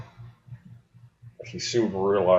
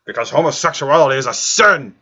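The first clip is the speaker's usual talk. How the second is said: higher and louder, building to a shouted "damn it"; over-the-top sermon shout; mock outrage; 8 semitones higher, 7 dB louder than usual. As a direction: ham up a preachy outburst. Mock-outraged closing rant.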